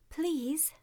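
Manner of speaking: put-on high, curious young princess voice; cartoon princess. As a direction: ask sweetly like a storybook princess. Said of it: "asked the princess".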